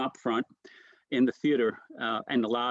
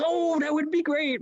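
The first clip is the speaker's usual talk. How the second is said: very high impersonated voice; Richie Menavini. Retold or imitated. Comic agrees to prank his friend, high-voiced impression. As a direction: imitate the comedian's high-pitched delight. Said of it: "He does that high voice".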